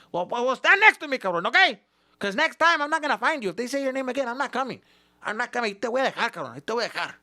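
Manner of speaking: high, loud threatening father; scolding dad. Retold or imitated. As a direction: warn him you'll leave him behind. Dad threatening to leave kid.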